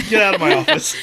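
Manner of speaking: high, loud mock dismissal; mock outrage. Host plays counselor ejecting a couple, mock outraged. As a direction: pretend to throw them out, loudly. Acted counselor throwing clients out.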